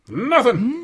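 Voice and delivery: high, loud, mock-anguished cry; mock wail. Hammed-up answer to what it got you, a high loud wail. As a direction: wail it like a heartbroken ballad. Dramatic answer to own question.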